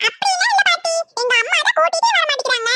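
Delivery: put-on high, light child's voice; a little kid pleading with granny; young grandchild voice.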